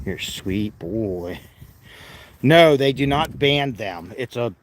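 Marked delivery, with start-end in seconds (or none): 0.1-1.4 soft pet talk. Real, not acted. Praising the animal.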